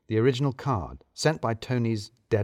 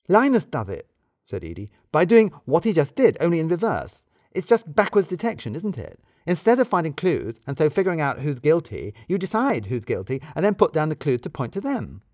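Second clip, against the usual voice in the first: higher, louder knowing explanation; clever Edie; 6 semitones higher, 4 dB louder than usual. Acted. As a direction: lay out the trick pleased with herself.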